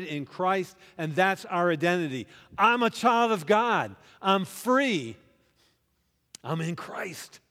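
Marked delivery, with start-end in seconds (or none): 2.6-7.4 high, loud call-and-response preaching; full-force preaching. Acted. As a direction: rouse the crowd to shout back. Preached affirmation again.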